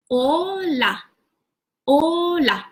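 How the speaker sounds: Hola said slowly and carefully, with a falling tune; pronunciation drill. Shown as a demonstration. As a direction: build the word "hola" back up from its syllables. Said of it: "How do we pronounce hola?".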